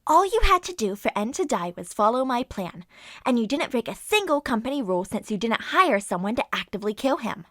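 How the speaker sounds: loud triumphant gloat, rushing near the end; loud smug villain speech. Acted. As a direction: crow over the victim as the plan succeeds. Villain gloats about the plan.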